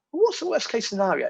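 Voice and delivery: worried investor asks the worst case; mock worried investor. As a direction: ask it like a nervous investor. Quoting typical investor question again.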